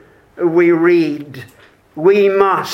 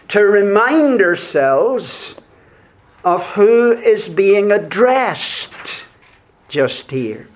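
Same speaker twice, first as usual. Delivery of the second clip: ordinary talk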